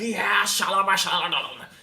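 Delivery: hammed-up glossolalia; mock tongues speaking. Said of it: "aquela muvuca". (imitando línguas estranhas)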